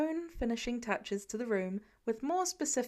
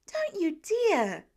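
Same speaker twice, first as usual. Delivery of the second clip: slow, much higher and louder; alarmed warning; 7 semitones higher, 5 dB louder, much slower than usual. A narrator's reading. Peter stopping Tony's plan, high alarmed warning. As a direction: cry it out, scandalised.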